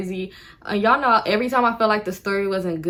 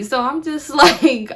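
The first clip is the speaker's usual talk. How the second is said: laughing through the words, higher and louder; 6 semitones higher, 6 dB louder, slower than usual. Real, not acted. Laughing as she tells viewers about the chase. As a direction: crack up mid-sentence.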